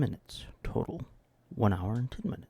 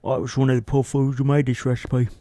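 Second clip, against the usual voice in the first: loud, lightly put-on voice; mock reviewer voice; 2 semitones higher, 10 dB louder, faster than usual. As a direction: sneer it like a crank.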